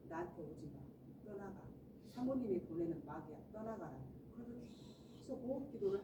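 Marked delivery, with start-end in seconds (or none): 0.1-4.0 low, soft rebuke kept inside; inner prayer. Acted. Evangelist silently rebuking the devil while praying, inwardly. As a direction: quietly order the devil away, as if thinking it. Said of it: "속으로".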